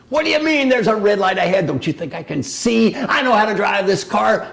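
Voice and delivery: loud, high, indignant outburst; defensive driver. Retold or imitated. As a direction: snap back at the passenger, offended. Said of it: "sounded a little more like".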